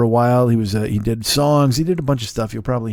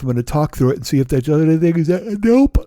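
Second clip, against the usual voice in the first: talking through a yawn; 2 semitones higher than usual. Real, not acted. Tired host trying to talk through a coming yawn, yawning. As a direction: fight off a yawn while talking. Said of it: "There's another yawn coming".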